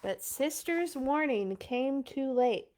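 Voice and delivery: plain reading voice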